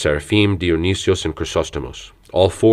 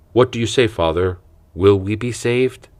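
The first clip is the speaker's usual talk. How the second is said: normal talking voice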